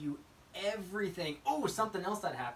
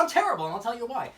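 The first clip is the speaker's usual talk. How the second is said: lightly mocked customer, louder and higher; oversharing customer; 7 semitones higher, 7 dB louder, faster than usual. Customer answering a simple how are you, mock oversharing. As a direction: launch eagerly into the complaint, hammed up.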